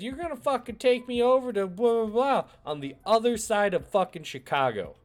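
high, loud ordering him around; bossy demand. Retold or imitated. Hooker ordering the uncle to drive her, loud bossy demand. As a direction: boss him across town. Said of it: "she said".

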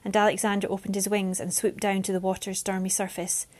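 plain reading voice

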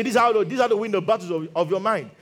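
ordinary talk, nothing marked

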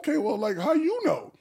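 high, pointed suspicious question; suspicious surprise. Retold or imitated. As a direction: call her out, suspicious. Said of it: "everybody was like".

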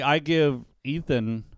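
ordinary delivery

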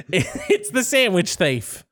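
slow, giggling mock accusation; laughing accusation. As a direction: drag it out while laughing.

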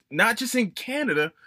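ordinary delivery

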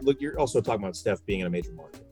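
usual speaking voice

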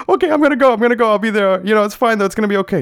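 high, loud, flustered reassurance; flustered placating. Retold or imitated. Son promising mom he'll go to school, flustered and high. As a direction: scramble to placate, voice jumping up.

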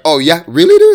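loud, high taunting challenge; mock challenge. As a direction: dare him, loud and high. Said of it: "I was like".

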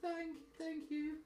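put-on slow, soft sniffly little boy; pinched voice. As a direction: sniff it out after crying. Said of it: "dabs his eyes and just goes".

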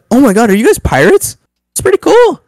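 high-pitched, mock-amazed god waking up; excited surprise. Dionysus wakes up among pirates, excitedly surprised. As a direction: play up the wide-eyed discovery, pitch climbing high.

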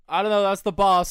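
loud, high-pitched shrugging off; retold excuse. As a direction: pass the blame loudly. Retold excuse to angry mother.

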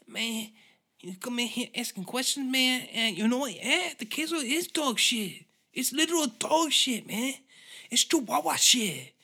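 very high, put-on aggrieved chef voice; cartoonishly worked-up owner ranting back; defensive restaurant owner. Head chef answering Gordon Ramsay's questions, high indignant rant. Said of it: "or the head chef's like".

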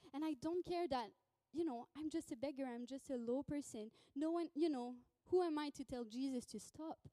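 usual speaking voice

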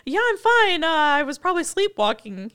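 high, embarrassed fake nonchalance; mock awkward cover story; hammed-up flustered woman; sheepish excuse. Terry soaked, excusing herself.

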